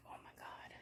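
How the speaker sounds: barely audible whispered gasp of disbelief; stunned whisper. Retold or imitated. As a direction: whisper it under your breath, imitating your shock. Stunned as the phone rings.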